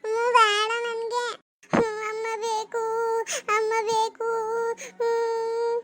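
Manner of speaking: slow, drawn-out tearful wail; crying for mother. Acted. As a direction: whimper and stretch each plea. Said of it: "ಏನ್ ಅಮ್ಮ ಅಮ್ಮ ಅಂದಿರಿ ಹಂಗೆ". (ಅಮ್ಮನಿಗಾಗಿ ರಾಗ ಎಳೆದು ಅಳುವುದು)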